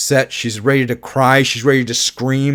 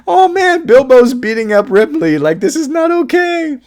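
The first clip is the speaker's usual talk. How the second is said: very high, louder playful squeal; hammed-up shock, pitched way up; mock outrage; 13 semitones higher, 5 dB louder than usual. Telling viewers Bilbo's beating up Ripley, high mock outrage.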